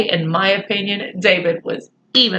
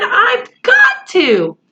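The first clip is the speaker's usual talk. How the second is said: desperate plea; 13 semitones higher, much slower than usual. A narrator's reading. Struggling boy, desperate plea.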